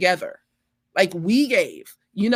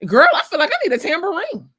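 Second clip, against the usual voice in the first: excited; 11 semitones higher, 6 dB louder, faster than usual. Real, not acted. Playful praise, wants tambourine.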